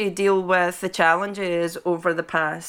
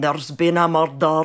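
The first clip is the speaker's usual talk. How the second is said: put-on Scottish accent; 5 semitones lower than usual. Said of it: "people do the Scottish accent by going".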